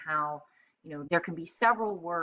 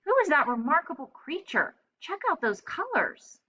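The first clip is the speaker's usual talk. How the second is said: much higher excited parrot voice; amazed parrot; 11 semitones higher than usual. Acted. Polly admires Napoleon, high and amazed. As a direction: gush about the colors up high. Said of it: "said Polly".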